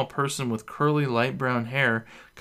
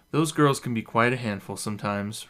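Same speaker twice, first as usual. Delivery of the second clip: plain reading voice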